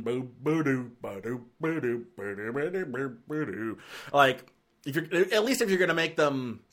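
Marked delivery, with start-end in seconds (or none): 0.0-3.8 lumbering bear noise. Shown as a demonstration. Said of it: "big, lumbering".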